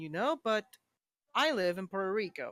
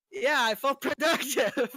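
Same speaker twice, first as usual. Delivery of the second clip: loud, high, laughing admission; 7 semitones higher, 7 dB louder than usual. Real, not acted. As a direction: let real laughter burst through the words.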